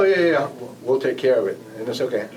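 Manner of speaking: ordinary talk, nothing marked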